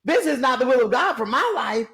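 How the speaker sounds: high-pitched mock disbelief; incredulous. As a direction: squeal "What?" then protest. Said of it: "I was like".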